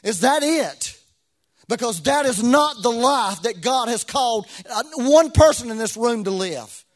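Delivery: loud, high, impassioned preaching with a sung lilt; full-force preaching. Acted. Pastor urging the congregation not to live in disappointment, loud and fervent. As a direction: lift the room with rising fervour.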